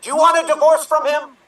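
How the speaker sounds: political drama. Acted. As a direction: demand an answer, fast. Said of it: "political drama".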